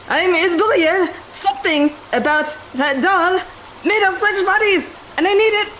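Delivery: high-pitched, slow emphatic outburst; hammed-up desperate demand; frantic insistence. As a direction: drill it into him, every word drawn out. Grabs him, insists on identity.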